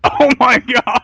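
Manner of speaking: loud, high, drawn-out exclamation; amused shock. Real, not acted. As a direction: react with laughing disbelief to a scandalous story. Shocked, laughing reaction to the story.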